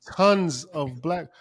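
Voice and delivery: ordinary talk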